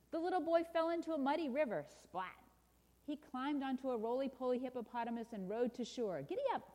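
animated storybook narration; children's story reading. A narrator's reading. Preacher reads a picture book to listeners, animated storytelling. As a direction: read it to children with lively emphasis.

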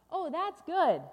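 high, loud burst of delight; delighted refrain. Acted. Storyteller reacts to the balloon ride, loudly delighted. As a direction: cheer the good news.

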